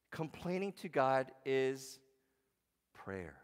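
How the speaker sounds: drawn-out set-up, then drop on prayer; slow build to reveal. Real, not acted. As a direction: stretch it slowly, then land the answer. Pastor builds to punchline.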